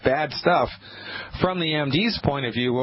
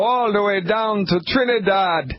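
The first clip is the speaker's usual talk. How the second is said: mock Trinidad accent; 6 semitones higher than usual. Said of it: "I've never been able to do the Trinidad accent".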